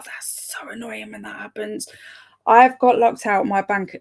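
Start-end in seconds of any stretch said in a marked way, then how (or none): 0.0-1.9 hushed, much softer aside; soft whispered groan of sympathy. Real, not acted. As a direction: commiserate under your breath.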